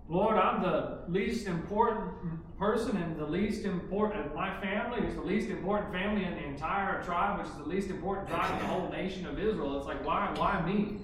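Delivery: loud, pleading protest of unworthiness; Gideon protesting. Retold or imitated. Preacher voicing Gideon begging the Lord, loud and pleading. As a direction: cry out that you are the least of all.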